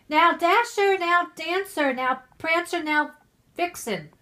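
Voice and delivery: loud high calling out; shouting reindeer names. Acted. Santa calling his reindeer by name, loud and high. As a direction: call the reindeer like a driver. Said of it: "he whistled and shouted and called them by name".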